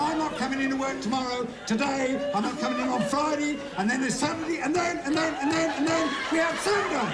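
high sing-song imitation of him; mocking mimicry. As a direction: parrot his excuses mockingly. Interviewer mocks candidate's excuses.

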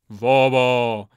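loud, booming crowd echo; chorus response. A narrator's reading. Warriors echo the old man, loud chant. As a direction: chant it back loudly.

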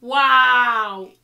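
loud drawn-out wow of disbelief; loud stunned wow. Real, not acted. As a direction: blown away, can't believe it. Reacting to host's reply.

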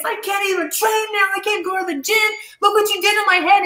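high, whiny mock sob; imitating crying man. Retelling how her attacker whined at her, high mock crying. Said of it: "He started crying".

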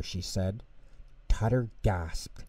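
plain reading voice

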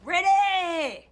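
loud, high eager yell; excited shout. Real, not acted. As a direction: shout it like you can't wait. Pumped-up reply to rock prompt.

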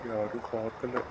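hushed, low aside to oneself; furtive. Acted. Man relieved nobody saw him leave, furtive. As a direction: say it softly under cover.

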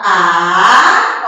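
A held long as a syllable on its own; separated syllable. Shown as a demonstration. Teacher separating syllables for children, A drawn out alone. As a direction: sound out a long, isolated A. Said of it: "pra separar as sílabas, a gente vai falando".

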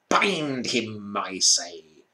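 lightly voiced witch command; witch repeating order. A narrator's reading. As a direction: insist with cold authority.

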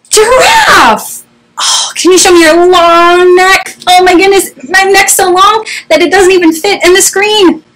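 put-on very high, wowed children's-presenter voice; high-pitched, playful mock astonishment; excited amazement. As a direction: marvel at it for small children. Amazed giraffe reveal to children.

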